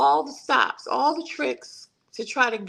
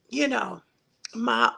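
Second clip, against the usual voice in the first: ordinary talk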